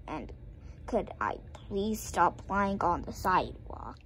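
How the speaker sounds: ordinary delivery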